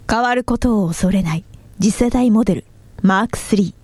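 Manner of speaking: movie trailer narration. Shown as a demonstration. Reads a car teaser cold.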